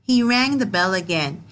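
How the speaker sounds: usual speaking voice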